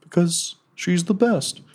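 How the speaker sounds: high, slow, hammed-up devotion; comic high-pitched adoring defence; pleading Slim Jim. Slim Jim's plea to governor.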